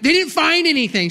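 high, loud blurt; confessing the prank to wife; blurted confession. Retold or imitated. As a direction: spill the truth in a burst. Admitting the prank, laughing.

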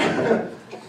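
soft laugh at his own joke; quiet breathy chuckle. Real, not acted. Preacher laughs at his own Jonah joke, quietly. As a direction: laugh under your breath. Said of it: "I cracked myself up".

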